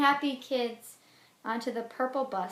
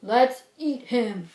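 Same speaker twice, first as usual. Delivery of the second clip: louder, slow menacing bear; papa bear voice; 9 dB louder, slower than usual. Acted. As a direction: growl the threat slowly. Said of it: "says Papa Bear".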